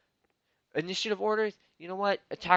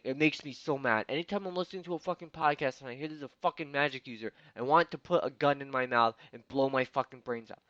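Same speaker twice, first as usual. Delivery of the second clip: ordinary talk, nothing marked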